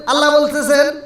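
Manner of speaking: normal talking voice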